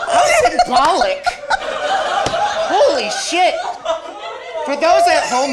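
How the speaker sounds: shouting through laughter; high loud laugh-filled outburst. Real, not acted. Sword hilt breaks, all laugh.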